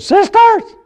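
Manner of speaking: high-pitched, loud cry imitating a small man. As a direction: call out high and loud, mimicking his high voice. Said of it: "he had a very high voice".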